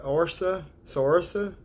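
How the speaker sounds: Orsa then Saorsa, sounded out syllable by syllable; spelling-out pronunciation. Shown as a demonstration. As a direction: sound out Saorsa slowly, one piece at a time. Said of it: "That's how I would say it".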